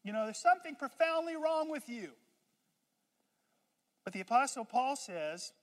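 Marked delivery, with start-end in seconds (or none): 0.0-2.1 high, loud scolding of the cheerful one; mock outraged rebuke, much higher and louder; exasperated Eeyore rant. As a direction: berate him like he's lost his mind. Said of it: "us Eeyores think".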